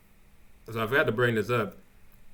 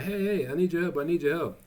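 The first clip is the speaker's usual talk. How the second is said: higher, hurried mock panic; freaking out; 6 semitones higher than usual. Trevor begging the mail lady for help, in mild mock panic. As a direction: play a light imitation of a frantic plea. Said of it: "he's freaking out like".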